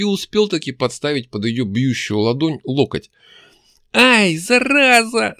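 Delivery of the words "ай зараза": high, loud, slow squeal of pain. A narrator's reading. Demon girl hurts her hand, squealing. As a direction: shriek as the hand hits an elbow. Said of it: "завизжала она".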